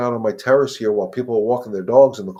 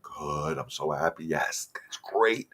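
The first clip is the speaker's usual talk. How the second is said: softer, unexcited praise for a dog; low-key praise; 4 semitones lower, 6 dB quieter, slower than usual. Shown as a demonstration. Trainer praising the settled dog, softly and calmly. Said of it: "without so much excitement".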